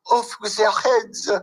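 French accent. Shown as a demonstration. Voice actor redoing the line as Marie Antoinette, French accent. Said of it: "in the French accent".

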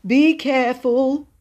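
high, slow, drawn-out warning; anxious murmur. A narrator's reading. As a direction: plead for care anxiously. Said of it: "he murmured".

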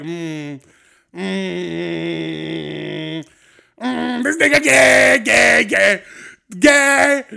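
Hangover movie character impression. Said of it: "the guy from Hangover, the Chinese dude, where he's like".

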